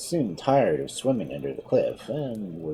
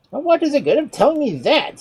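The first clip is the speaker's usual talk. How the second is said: weepy; 8 dB louder than usual. Acted. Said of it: "he asked in a weepy voice".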